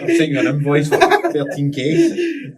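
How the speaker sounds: high, slow, loud through laughter; laughing. Real, not acted. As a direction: laugh the joke out while saying it. Joking about a huge invoice.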